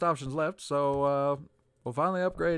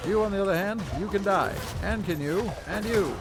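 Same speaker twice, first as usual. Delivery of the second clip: taunting; 4 semitones higher than usual. Acted. Taunting enemies in combat.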